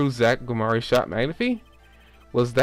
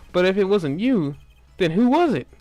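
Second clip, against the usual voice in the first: higher, louder startled question; surprised demand; 6 semitones higher, 3 dB louder than usual. Acted. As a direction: press hard in disbelief. Startled defense demands the culprit.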